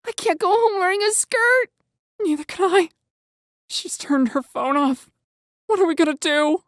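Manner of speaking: high, anxious character voices; fretful boys' voices. A narrator's reading. Boys voiced in the story.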